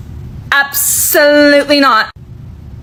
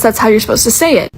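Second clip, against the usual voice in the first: ordinary talk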